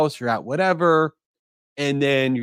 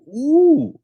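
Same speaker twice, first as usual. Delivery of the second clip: high, loud intrigued ooh; a sudden hooked gasp of interest; 12 semitones higher, 7 dB louder than usual. Retold or imitated. Reader seeing a juicy subject line, hooked ooh. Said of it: "you're instantly like".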